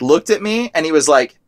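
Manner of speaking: normal talking voice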